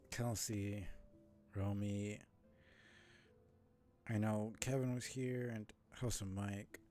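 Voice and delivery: normal talking voice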